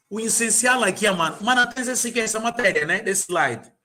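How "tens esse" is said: ordinary talk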